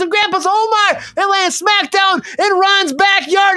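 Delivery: high, giddy, runaway riff; manic excited rant. Acted. Signing off to viewers, a wild high excited riff. Hyped sign-off rant spirals.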